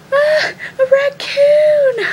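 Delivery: very high, loud, drawn-out panicked shriek; mock scream. Friend screaming at a raccoon by the door, hammed-up terrified shriek. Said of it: "she screams".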